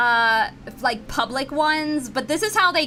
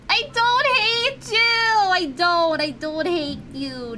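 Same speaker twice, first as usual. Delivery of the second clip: high, pleading reassurance settling down slower; done being mean; 6 semitones higher, slower than usual. Pretend. Streamer reassuring chat after mock anger, high and soothing. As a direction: coo it high, then ease down. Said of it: "I'm done being mean".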